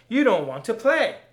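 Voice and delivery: loud, high scream. Acted. Poet screaming at the tempter he doesn't want to play, loud. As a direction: scream it in his face. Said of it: "I screamed".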